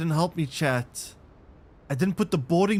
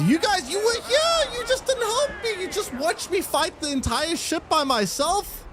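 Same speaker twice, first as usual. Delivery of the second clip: mock outrage; 14 semitones higher than usual. Real, not acted. Captain yelling at idle crew in high-pitched mock outrage. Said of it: "I'm very upset".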